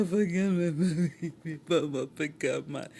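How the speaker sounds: slow, loud blubbering wail; mock crying. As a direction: bawl the words out in exaggerated sobs. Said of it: "are you crying?".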